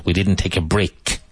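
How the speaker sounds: "break" ending in a crisp, strong K; "didn't" and "break" said with clear consonants. Shown as a demonstration. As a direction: snap the K at the end of "break". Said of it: "Break with a strong K sound".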